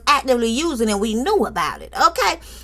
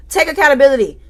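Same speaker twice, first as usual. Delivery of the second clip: scolding; 5 semitones higher than usual. Real, not acted. Host lecturing Tisha to take accountability, raised voice. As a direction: lay down the law, take accountability.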